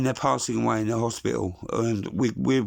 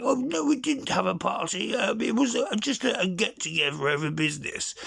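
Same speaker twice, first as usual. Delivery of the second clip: high, flustered Boris denial; Boris impression; 9 semitones higher than usual. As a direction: squeak out the excuse in a high pitch. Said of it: "Then gets caught having".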